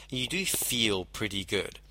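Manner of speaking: slow, soft you do feel pretty good; emphasized phrase. Shown as a demonstration. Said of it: "where I'm emphasizing the words".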